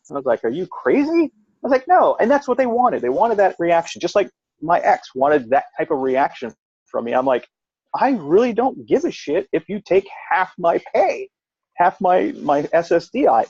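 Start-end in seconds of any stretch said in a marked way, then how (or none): 0.4-2.2 high-pitched mock disbelief; retold incredulity. Answering the people about the cake, incredulous. Retelling his incredulous reply to questioners. 7.9-13.4 high, swooping emphatic retort; retold defiant indifference. Telling the ex to take half, defiantly unbothered.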